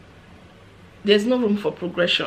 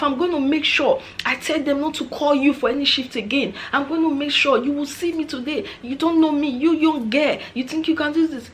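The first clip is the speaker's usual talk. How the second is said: scolding Nigerian woman; 7 semitones higher than usual. Retold or imitated. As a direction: berate the young girl like a furious Nigerian elder. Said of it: "I'm gonna behave like a Nigerian woman".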